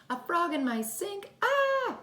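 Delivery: mother shrieking at a frog in her sink. Acted. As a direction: scream at the frog. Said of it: "cried his mother".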